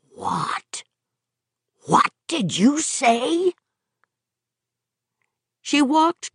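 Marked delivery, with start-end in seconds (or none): 0.1-0.8 stunned, breathless whisper; astounded whisper. A narrator's reading. 1.9-3.5 astounded. A narrator's reading.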